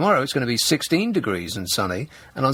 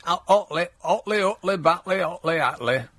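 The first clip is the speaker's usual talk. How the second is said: mock Yorkshire caller, badly faked; half-hearted Yorkshire impersonation; bad Yorkshire accent; 4 semitones higher than usual. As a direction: imitate an annoyed Yorkshire caller, not very well. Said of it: "I can't do a Yorkshire accent".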